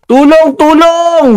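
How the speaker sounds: high, drawn-out cry for help; acted distress call. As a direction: call out slowly to someone in the distance. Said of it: "parang may naririnig yata ako na sumisigaw".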